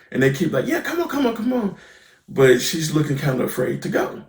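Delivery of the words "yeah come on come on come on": high-pitched coaxing, imitating the kids; playful imitation of kids urging a friend along; mock urging. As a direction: egg her on in a high voice. Voicing kids urging Elle along.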